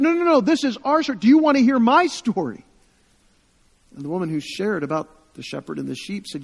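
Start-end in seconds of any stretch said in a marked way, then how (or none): 0.0-2.6 high, loud and fast, pressing her point; eager insistence. Retold or imitated. Refugee pressing the storyteller to hear her story, eager and insistent. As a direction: urgently insist she has a story to tell.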